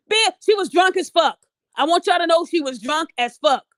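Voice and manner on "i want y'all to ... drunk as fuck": ordinary talk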